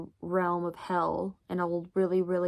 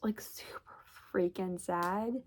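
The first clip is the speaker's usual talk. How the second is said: hushed whisper; 6 dB quieter than usual. Real, not acted. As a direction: whisper it, heavy with sadness. Whispers the sad part of story.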